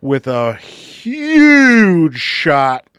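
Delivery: very slow, stretched announcement; drawn-out announcer. Pretend. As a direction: drag the name out like an arena announcer. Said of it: "I could even drag that out a little bit longer".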